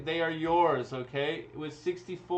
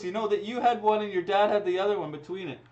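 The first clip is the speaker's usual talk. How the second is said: ordinary talk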